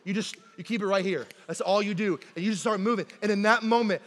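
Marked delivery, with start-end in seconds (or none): none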